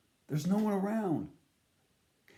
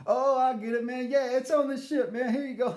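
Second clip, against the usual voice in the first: high, loud, chuckling; laughing bartender; 12 semitones higher, 6 dB louder than usual. Retold or imitated. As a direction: get the joke and laugh through it. Bartender catching the joke.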